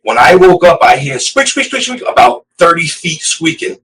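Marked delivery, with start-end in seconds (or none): 0.8-2.0 high-pitched squeak sounds, rising sharply; imitated squeaks. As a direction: squeak it out like a door hinge.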